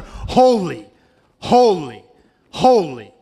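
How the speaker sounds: loud, high cry falling away on each word; ringing pulpit proclamation, spaced out. Acted. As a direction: proclaim it like seraphim calling to one another. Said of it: "screaming out to one another".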